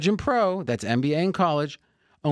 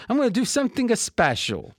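put-on higher-pitched, playful Italian accent; mock Italian accent; 7 semitones higher than usual. Host announcing a special deal, put-on Italian accent. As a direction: lift it into a joking Italian sing-song.

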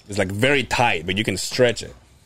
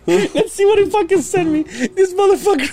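high, laughing outrage at a friend's prank; laughing disbelief. Real, not acted. Reacting to a prank text, laughing high. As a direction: crack up while opening the message. Opening a friend's gross message.

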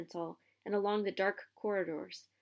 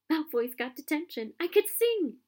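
gleeful; 7 semitones higher, 6 dB louder than usual. Acted. Hermione gleeful on tower.

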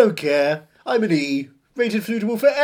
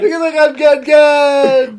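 loud, slow belt with a laugh in it; belted again on request; 6 semitones higher, 6 dB louder, slower than usual. Pretend. Repeating the punchline on request, loud and slow. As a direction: shout it out once more, grinning. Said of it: "Say it again".

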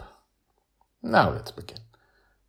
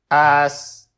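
loud, raised machine tone; robotic Mastermind; 9 semitones higher, 10 dB louder than usual. A narrator's reading. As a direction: start it evenly like a computer. Robot begins the curse word.

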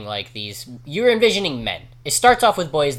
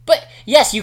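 exasperated protest; 7 dB louder than usual. Retold or imitated. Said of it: "And you're like".

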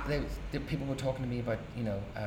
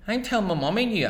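put-on fast, higher and louder childish threat; tattling boy; 11 semitones higher, 8 dB louder, faster than usual. Boy threatening to tell his mother, fast high whine. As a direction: whine it like a kid telling on you. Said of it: "I say".

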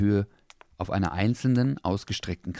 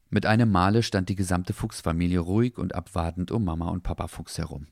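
plain reading voice

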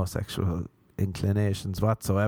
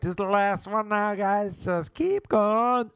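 high, slow, drawn-out "just keep going"; donkey-like teacher; 9 semitones higher than usual. Retold or imitated. Teacher promising the class it is the last one, high drawn-out bray. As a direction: stretch it out like a braying coach. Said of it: "Like a donkey testing a microphone".

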